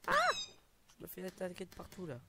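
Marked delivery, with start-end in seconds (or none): none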